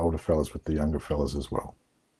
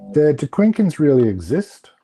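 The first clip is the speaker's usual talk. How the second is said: higher, louder voice with a questioning lift; son's wondering question; 7 semitones higher, 7 dB louder than usual. Retold or imitated. Son asking his dad about rock paintings, wondering. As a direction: imitate a boy earnestly asking his dad.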